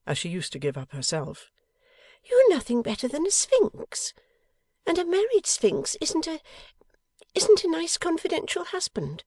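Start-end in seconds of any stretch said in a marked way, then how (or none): 2.2-9.2 high, louder wifely complaint; injured tone. A narrator's reading. Wife reproaches secretive husband, injured. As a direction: sound wronged, pitched far up. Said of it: "in a tone of injury".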